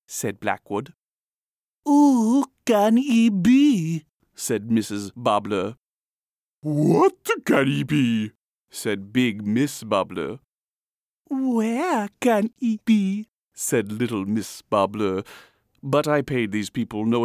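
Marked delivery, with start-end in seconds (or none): 1.9-4.1 loud, very high, drawn-out wondering; curious society lady. A narrator's reading. Mrs. voices society curiosity. 6.6-8.3 slow, loud, raised-pitch wondering; curious big sister. A narrator's reading. Big Miss echoes her mother. 11.3-13.3 very high, drawn-out little miss; curious little sister. A narrator's reading. Little Miss echoes the others.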